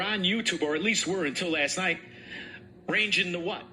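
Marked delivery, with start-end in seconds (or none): none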